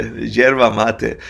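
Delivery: yerba mate said slowly as YER-bah MAH-teh; slow clear pronunciation. Shown as a demonstration. Guest teaching the host to say yerba mate slowly. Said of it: "mate, not the matcha tea".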